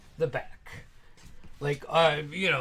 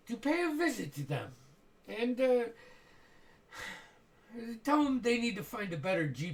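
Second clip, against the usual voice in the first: sarcastic menace with a false friend; dripping with venom; 4 semitones higher, 6 dB quieter than usual. Acted. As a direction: purr threats politely. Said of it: "Dripping with venom".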